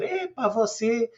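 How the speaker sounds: a hint of a wide-eyed Lisbon resident; impressed tourist. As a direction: lightly play the delighted local who never knew. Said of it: "saem de lá a dizer".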